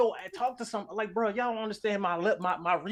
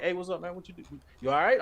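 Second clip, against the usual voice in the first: casual greeting; faster than usual. Acted. Greeting Sam as he walks past on set, casual. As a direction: greet a co-worker in passing, nothing special. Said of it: "It's more like".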